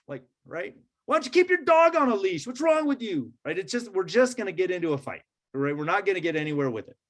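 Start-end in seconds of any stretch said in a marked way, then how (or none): 1.1-3.3 much higher, louder indignant comeback; defensive retort. Retold or imitated. Speeder firing back at neighbor, indignant and high. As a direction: snap back in offended defense. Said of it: "I'm just gonna come at you and be like".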